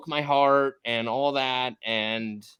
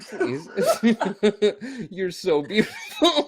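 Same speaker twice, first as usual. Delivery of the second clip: giggling through the compliment; laughing gush; 5 semitones higher, 3 dB louder than usual. Real, not acted. Gushing at celebrity guest Jillian while laughing. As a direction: laugh it out, overwhelmed.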